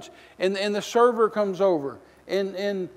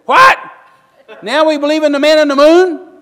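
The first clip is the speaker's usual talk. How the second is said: a much higher, much louder outraged shout; retold yell; 9 semitones higher, 13 dB louder than usual. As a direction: yell it at the TV in disbelief. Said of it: "And I'm yelling at her".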